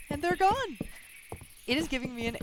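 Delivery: high, loud cry of dismay; sudden horrified yelp; shocked outburst. Real, not acted. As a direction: let the shock burst out. Discovering files deleted.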